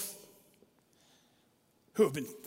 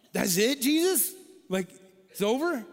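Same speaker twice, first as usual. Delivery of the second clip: much higher, louder disbelieving cry; incredulous; 8 semitones higher, 8 dB louder than usual. Retold or imitated. Pastor protesting Jesus's brief pardon, loud incredulous cry. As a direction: burst out in disbelief.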